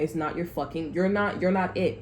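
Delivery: ordinary delivery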